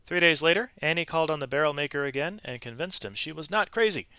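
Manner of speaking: usual speaking voice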